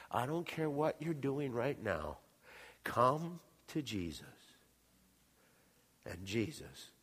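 ordinary talk, nothing marked

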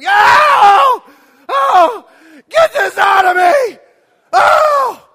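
high, very loud screams; hammed-up screaming from the maternity ward; mock labor scream. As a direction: wail like a woman down the hall in labor. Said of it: "a woman scream".

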